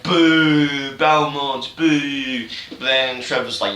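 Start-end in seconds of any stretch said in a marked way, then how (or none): 0.0-2.5 mock booing crowd. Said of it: "everyone's like".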